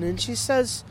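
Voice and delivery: ordinary talk, nothing marked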